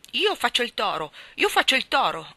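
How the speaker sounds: put-on high, loud, eager child's cry; excited shouting. A narrator's reading. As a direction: shout it out, claiming the role first. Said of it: "gridò Pip".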